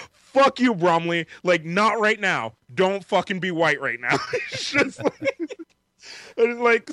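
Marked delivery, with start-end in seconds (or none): 0.1-4.2 hammed-up angry retort, pitched high; Max. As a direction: imitate a fed-up friend yelling back. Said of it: "is making me mad".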